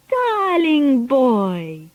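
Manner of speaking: slow, high cooing witch voice. A narrator's reading. Grand High Witch coos sweetly to Bruno. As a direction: draw it out sweetly like a wicked witch. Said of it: "cooed the Grand High Witch".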